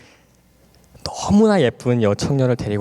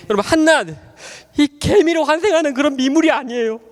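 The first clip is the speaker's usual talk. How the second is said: fervent preaching; 10 semitones higher than usual. Acted. Preacher urging the congregation toward the light, full fervent preaching. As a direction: preach it out to the whole hall.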